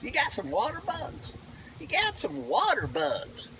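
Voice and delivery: You got some water bugs in a high, drawn Southern twang; a playful Southern local voice; Southern accent. Shown as a demonstration. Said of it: "as they call them in, here in the South".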